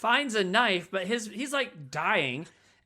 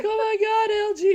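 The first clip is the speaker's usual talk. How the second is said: much higher, louder mock shock; shocked gasp; 6 dB louder than usual. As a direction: cry out in horror at a loved one. She sees him rising.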